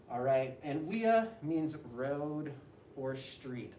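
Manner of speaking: ordinary talk, nothing marked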